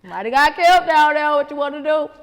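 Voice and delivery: loud high nosy grandma voice; grandma calling out. Retold or imitated. Grandma calling to the kids, loud and high. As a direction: yell it like grandma hollering down.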